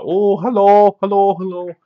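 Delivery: high, loud sing-song clown calling hello; creepy clown greeting. Retold or imitated. Killjoy rattling the outhouse, high loud clown calling. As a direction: call out like a gleeful killer clown.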